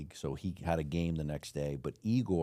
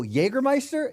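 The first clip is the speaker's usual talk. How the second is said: hopeful question; 6 dB louder than usual. Retold or imitated. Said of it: "I go".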